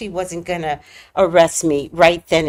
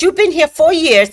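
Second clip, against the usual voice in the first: louder, higher scolding; mock yell; 6 semitones higher, 6 dB louder than usual. Officer yells about living here four years, mock yell. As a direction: scold her like she should know. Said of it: "she yelled at me".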